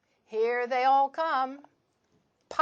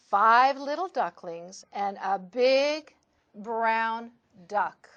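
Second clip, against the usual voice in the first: plain reading voice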